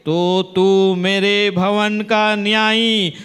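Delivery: forceful preaching. Real, not acted. Preacher proclaiming God's conditions to congregation, forceful preaching. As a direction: preach it hard, building to a loud peak.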